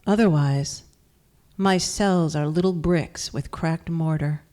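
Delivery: measured poem recital with long pauses between lines; poetry reading. Acted. Poet reads from her first book to an audience, measured recital. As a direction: give each line its own space, firmly voiced.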